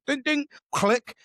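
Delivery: high "ding ding" and click; imitated ejection. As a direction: mimic an ejector seat going off. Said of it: "like an ejector sheet".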